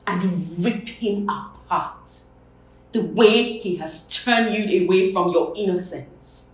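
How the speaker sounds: loud, forceful outburst of rage; raging, hard-hit words; vengeful fury. Acted. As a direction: erupt into a loud vow of revenge. Vow of revenge erupts.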